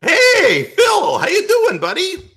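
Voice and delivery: very high, hearty call-out to a caller; in-character greeting. Acted. Host greeting a late caller, high and exuberant.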